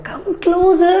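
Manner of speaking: high-pitched beckoning crocodile voice; coaxing crocodile. A narrator's reading. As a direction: lure the little one closer in a high voice. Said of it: "said the crow".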